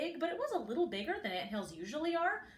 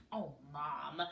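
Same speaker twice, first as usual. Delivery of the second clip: soft, lower grouchy whine; 8 semitones lower, 5 dB quieter than usual. A narrator's reading. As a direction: sound put out by mom's idea. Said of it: "in her best grouchy voice".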